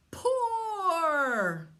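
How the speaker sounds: put-on high loud baby dragon cry; wrong roar. Baby dragon gets the roar wrong, loud and high. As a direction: shout door like a silly baby dragon. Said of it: "Mama cries".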